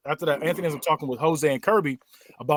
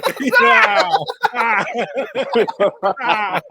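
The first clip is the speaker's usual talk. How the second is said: loud hammed-up scream, higher and louder; mock nightmare scream; 6 semitones higher, 6 dB louder, slower than usual. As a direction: scream like waking from a nightmare, half laughing. Acting out waking from nightmare.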